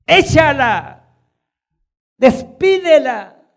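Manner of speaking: loud, slow dismissive command; slow loud sneer of the disciples; disciples' rebuke. Retold or imitated. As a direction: drive her off with scorn. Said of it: "Saben que los cananeos".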